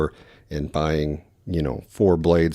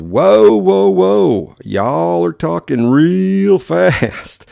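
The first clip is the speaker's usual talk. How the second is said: loud, high, slow hammed-up Southern drawl; Southern drawl caller; 11 semitones higher, 7 dB louder, much slower than usual. Caller from The Rock telling him to slow down, in a mock Southern drawl. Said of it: "And he says".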